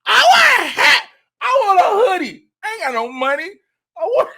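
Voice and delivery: very high, loud squeal easing down; overjoyed. Retold or imitated. Comedian reliving walking into Buc-ee's, overjoyed shrieking. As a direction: shriek each wish like a delighted kid, then settle.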